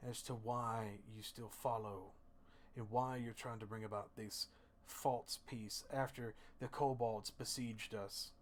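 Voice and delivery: soft, slow, deliberate interrogator; quiet threat, long pauses between phrases; menacing jailer. Acted. King's inquisitor demanding the truth from his prisoner, hushed menace. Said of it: "He looks at you and he goes".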